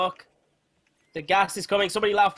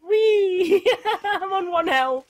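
high squeal through laughter; hysterical laughter; 14 semitones higher, 5 dB louder, much slower than usual. Real, not acted. Player nearly dead in the fight, hysterical laughing. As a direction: laugh helplessly while squealing it.

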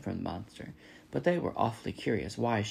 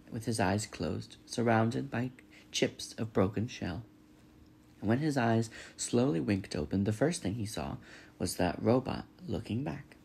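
ordinary delivery, nothing marked

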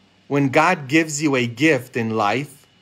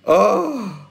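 higher, hammed-up gasp and "oh"; mock astonishment; 4 semitones higher than usual. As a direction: pretend to be taken aback. Priest reacts to donation offer.

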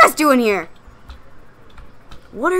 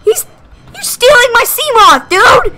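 frantic yelling; 10 semitones higher, 7 dB louder than usual. Real, not acted. Monster takes Seamoth.

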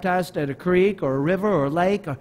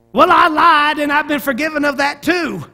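put-on Mississippi accent; 10 semitones higher, 9 dB louder than usual. Delmer answers Everett after his baptism, loud Mississippi accent. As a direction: holler it in a big Mississippi accent. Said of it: "That's my best Mississippi, Mississippi accent".

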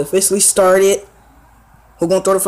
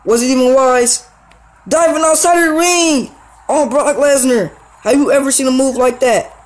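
hyped; 5 semitones higher than usual. Real, not acted. Diving move outside the ring.

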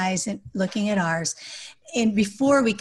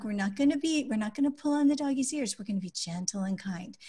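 gentle parent, settling into a soft close; really calm voice; 4 dB quieter than usual. Shown as a demonstration. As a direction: calmly guide a small child. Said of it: "we say it in a really calm voice".

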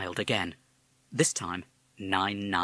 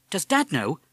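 put-on young boy; 7 semitones higher, 5 dB louder than usual. Boy asks.

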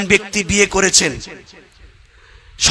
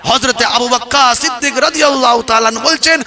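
ordinary talk, nothing marked

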